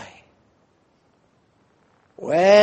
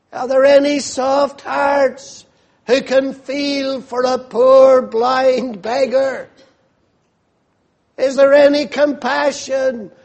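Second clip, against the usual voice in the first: wrong kind of cry; 4 semitones higher than usual. Shown as a demonstration. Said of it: "he doesn't cry like that".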